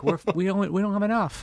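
high, small goat voice. Acted. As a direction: squeak it like a goat. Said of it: "they were like".

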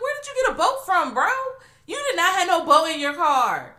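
laughing high-pitched disbelief; incredulous mock. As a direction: squeal it in amused disbelief. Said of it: "So I'm like".

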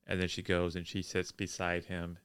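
normal talking voice

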